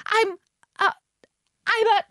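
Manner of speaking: high, halting stammer; flustered faltering. A narrator's reading. Alice unsure what she is, faltering.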